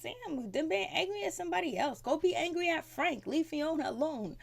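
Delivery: high-pitched, exasperated telling-off; exasperated mock scolding. Fan telling Ian to leave Fiona alone, exasperated. Acts out own retort to character.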